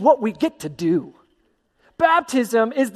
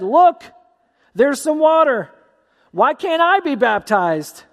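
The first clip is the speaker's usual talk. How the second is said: eager eunuch; 5 semitones higher, slower than usual. Acted. Eunuch asks Philip to baptize him, eager and higher. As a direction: blurt it like a man who just spotted water. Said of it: "and the eunuch said".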